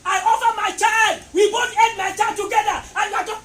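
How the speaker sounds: shouted, high retort of the stepmother; angry stepmother. Retold or imitated. Stepmother shouting at the father during their fight, angry. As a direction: yell it back at him defiantly. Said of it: "She said".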